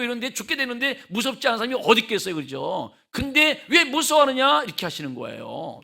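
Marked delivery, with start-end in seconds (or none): 3.7-4.6 loud, high scolding question; Jesus scolding fearful disciples, raised voice; rebuking. Retold or imitated. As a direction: rebuke them sharply. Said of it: "책망하시는 거예요".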